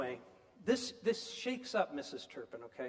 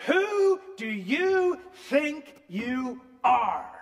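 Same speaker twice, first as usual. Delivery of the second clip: slow, loud, higher roar; 7 semitones higher, 10 dB louder, much slower than usual. Retold or imitated. Mrs Turpin roars at God in fury. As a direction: bellow it at the sky. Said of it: "she roared".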